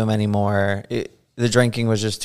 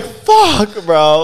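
much higher, laughing dismay; 9 semitones higher, 6 dB louder than usual. Retold or imitated. Artist answering his boss, laughing dismay. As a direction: groan it out amused and doomed. Caught out, mortified.

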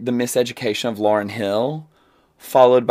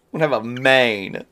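laughing; 8 dB louder than usual. Real, not acted. Joking about being bald.

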